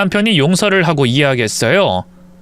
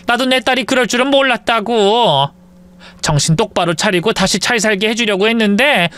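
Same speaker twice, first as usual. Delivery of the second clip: put-on higher, exasperated old mother; lamenting mother-in-law; 5 semitones higher than usual. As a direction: sigh over a runaway daughter as an old mother. Mother-in-law laments runaway daughter.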